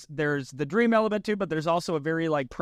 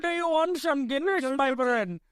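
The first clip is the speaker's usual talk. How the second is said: high-pitched mock Indian-Irish accent; 8 semitones higher than usual. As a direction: imitate a botched Irish accent that comes out Indian.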